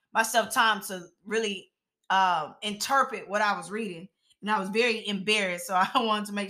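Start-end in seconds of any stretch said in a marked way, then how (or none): none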